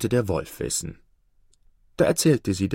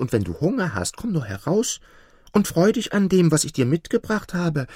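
lightly voiced wheedling falcon; coaxing falcon; 7 semitones higher than usual. A narrator's reading. Falcon luring the partridge out of its nest, coaxing. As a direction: coax with a lifted, lively voice.

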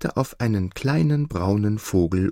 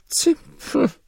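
high squeaky clipped-off grunt; a comic steward's grunt. Acted. Steward's curt reply.

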